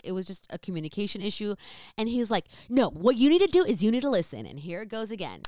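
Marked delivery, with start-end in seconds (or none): 2.7-4.5 angry lecture. Retold or imitated. Angry brother lecturing her.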